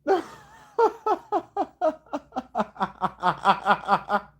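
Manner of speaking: high, loud laughing fit; long laugh. Real, not acted. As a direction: crack up laughing.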